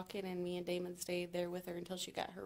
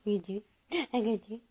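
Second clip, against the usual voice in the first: much higher, louder sing-song cooing; baby talk; 7 semitones higher, 12 dB louder, slower than usual. Acted. Mom cooing kisses at her baby, high loud sing-song baby talk. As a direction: coo at the baby in a bright lilting voice with rising kisses.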